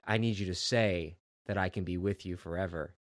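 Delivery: put-on low, soft sinister demon baby. Retelling the comic, demon baby demands her love, low and soft.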